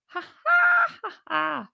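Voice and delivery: high, shouted mock laugh. Real, not acted. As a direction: cackle gleefully at the news. Mocking laugh at his loss.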